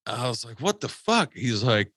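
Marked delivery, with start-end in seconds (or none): 0.6-1.3 high, stunned "what the fuck"; shocked outburst. Retold or imitated. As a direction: disbelief flung out in a high voice. Reacting to the shooting story.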